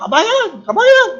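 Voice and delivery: acted high holler; calling out. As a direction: imitate hailing a countryman down the street. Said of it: "Sumisigaw siya".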